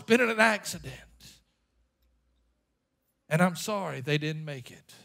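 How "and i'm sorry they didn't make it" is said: very low, soft, stunned voice; hushed bad news. Preacher voicing a parent's dreaded night call, hushed and stunned. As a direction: drop almost to a murmur, heavy with shock.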